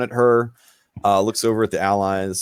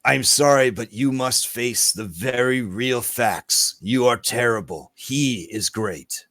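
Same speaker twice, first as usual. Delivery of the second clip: blunt lizard folk. Acted. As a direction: deliver hard truths with measured gravity. Game master voices lizard folk.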